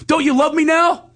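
very high, loud whiny plea; needy mock plea. Mocking his own needy self to his ex-wife, high whiny plea. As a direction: beg for love like a needy child.

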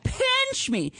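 giddy disbelief; excited. Real, not acted. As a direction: leap way up in pitch, giddy. Thrilled about the cat.

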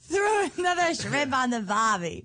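slow, wobbly Australian accent; badly faked Australian, drawn out. Shown as a demonstration. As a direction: beginner copying an Australian accent. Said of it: "Just repeat after me".

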